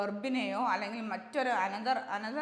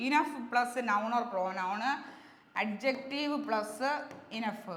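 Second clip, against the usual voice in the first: slow formula recital; much slower than usual. Shown as a demonstration. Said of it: "ഇത്ര ഓർത്താൽ മതി".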